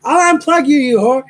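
high, loud mock-furious threat; retold outburst. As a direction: act out finally snapping at Alexa. Retold final threat to Alexa.

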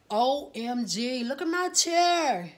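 drawn-out, high, loud excitement; slow, rising squeal of delight; gushing. Real, not acted. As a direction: stretch it out in a loud, thrilled gush. Showing off her chair.

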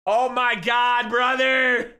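loud, high and drawn out; excited outburst. Real, not acted. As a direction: gush loudly in disbelief. Streamer overwhelmed by big gift.

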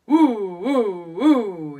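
loud, swooping low woo pushes. Shown as a demonstration. Teacher goes first on pushes, low voice. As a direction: push each woo out in the low register. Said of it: "low voice".

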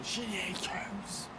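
whisper. Pretend. Echoing the ad jingle.

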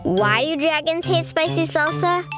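plain reading voice

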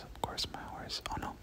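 usual speaking voice

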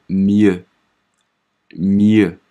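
mieux stretched very slowly; slow French demo. Shown as a demonstration. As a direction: draw out mieux for the class. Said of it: "所以读". (极慢示范读mieux)

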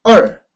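얼 loud, falling sharply from high; fourth-tone demo. Shown as a demonstration. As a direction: drop 얼 steeply in Mandarin fourth tone. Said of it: "사 성이네요".

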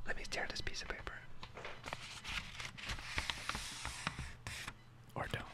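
soft breathy ASMR whispering. Acted. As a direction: whisper close to the mic.

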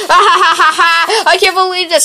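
slow, laughing through the words; laughing disbelief. Acted. Chasing the thief and laughing, slow laughing disbelief.

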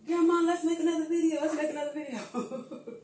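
put-on high, eager little boy voice, laughing by the end; imitating her grandson. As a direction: play the pestering grandkid, then crack up.

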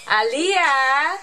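a loud high call across the room. Real, not acted. Mother calling her daughter before filming, loud high call. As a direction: call her name to get her attention. Mother calls child by name.

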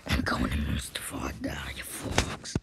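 very low, voiceless croak; hammed-up sinister growl; villain rasp. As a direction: rasp the threat in a hushed villain voice. Acted villain threat.

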